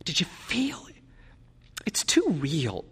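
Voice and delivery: tearful, whispered questions; crying. A narrator's reading. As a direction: half-whisper through tears. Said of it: "cried steadily".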